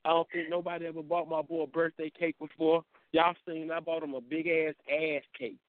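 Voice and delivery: usual speaking voice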